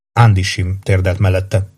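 usual speaking voice

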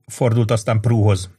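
usual speaking voice

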